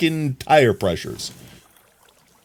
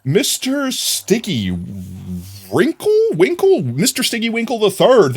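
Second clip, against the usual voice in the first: excited; 9 semitones higher than usual. Real, not acted. Reading follower name.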